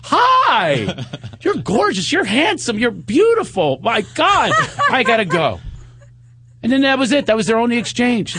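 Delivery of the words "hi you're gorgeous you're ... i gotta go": gushing greeting. Retold or imitated. Imitating Sarah's gushing greeting.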